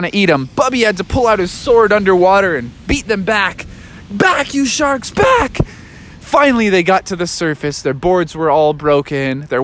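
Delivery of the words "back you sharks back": higher, slow, rising to a shout; Bubby's battle cry. Acted. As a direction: drive off the sharks bravely. Bubby fights sharks.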